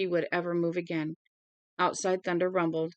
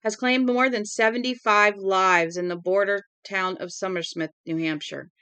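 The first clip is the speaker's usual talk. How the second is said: crisp newscaster read, a bit louder; radio news bulletin; 3 semitones higher, 5 dB louder than usual. Acted. Broadcaster on the radio announcing the deadly fire, news read. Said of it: "The broadcaster said".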